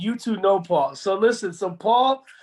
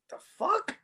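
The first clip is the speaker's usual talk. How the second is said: stunned; 11 semitones higher, 4 dB quieter than usual. Retold or imitated. His stunned reaction retold.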